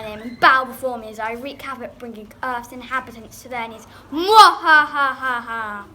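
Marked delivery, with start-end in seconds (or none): 4.1-5.9 slow, very loud evil laugh; evil villain cackle. Acted. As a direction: throw back your head and cackle.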